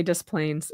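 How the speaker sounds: "Des Plaines" said as dess-plainz; Illinois Des Plaines. Shown as a demonstration. Explaining the awful Illinois way, "Des Plaines" as dess-plainz. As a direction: give "Des Plaines" its English s sounds. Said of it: "It's really awful".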